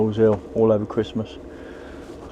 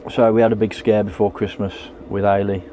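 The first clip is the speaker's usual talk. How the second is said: normal talking voice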